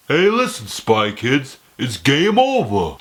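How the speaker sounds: hammy villain taunt, drawn out at the end; Toymaker. Acted. Villain taunting the kid heroes, hammed-up impression. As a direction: taunt them like a cartoon baddie. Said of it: "I'm the toy maker".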